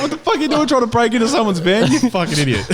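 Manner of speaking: loud, high, laughing yell; laughing mock yell. Fighter retelling how he yelled at the woman. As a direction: yell it back through giggles. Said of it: "retold yelling at woman".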